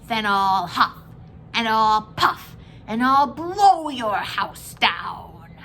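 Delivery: loud, slow wolf threat, ending in a breathy hiss; big bad wolf. A narrator's reading. Wolf threatening the pigs, loud and slow. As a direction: snarl the threat at the door. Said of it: "So the wolf showed his teeth".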